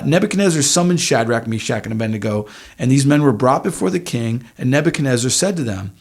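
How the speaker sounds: ordinary talk, nothing marked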